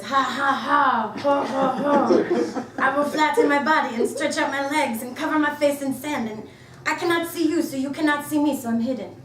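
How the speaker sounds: high, loud mock French villain; fake French accent. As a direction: play a hammy French spider. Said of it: "The fake French voice says".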